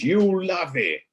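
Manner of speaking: slow, high Cockney finish; Cockney accent. Shown as a demonstration. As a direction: stretch it out slowly and high in broad Cockney. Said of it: "using a nice Cockney accent".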